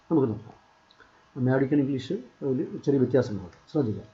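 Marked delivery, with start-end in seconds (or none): none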